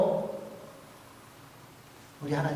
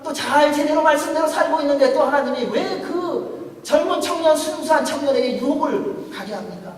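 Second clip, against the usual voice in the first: loud, high, rousing sermon climax; full-force preaching; 9 semitones higher, 9 dB louder than usual. Acted. Pastor proclaiming God's presence to the congregation, loud and rousing. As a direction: proclaim it to the back row. Impassioned retelling of Joseph.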